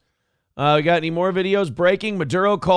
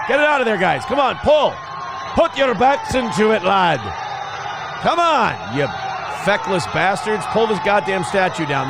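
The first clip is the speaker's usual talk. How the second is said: harsh barking commands; 4 semitones higher than usual. Pretend. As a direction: bark orders at the crowd. Yelling orders at statue crowd.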